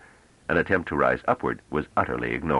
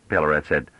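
ordinary delivery

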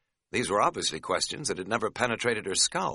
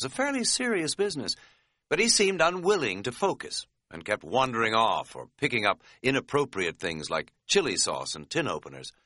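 ordinary delivery, nothing marked

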